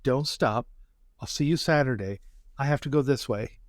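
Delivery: ordinary delivery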